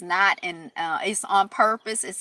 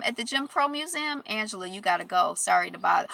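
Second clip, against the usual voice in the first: ordinary talk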